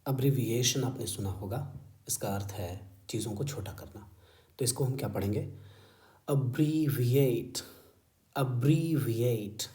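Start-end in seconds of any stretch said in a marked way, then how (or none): 6.3-9.7 Abbreviate slow, with a long stressed BREE; stressed model pronunciation. Shown as a demonstration. Teacher models the style of the word, Abbreviate stressed uh-BREEE-vi-ate. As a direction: stretch and lean on the second syllable of Abbreviate. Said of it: "ये style है इसकी".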